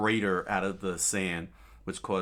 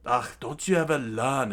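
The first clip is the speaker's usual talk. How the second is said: sneering villain; 5 semitones higher, 6 dB louder than usual. Acted. Crocodile sneers at Luffy.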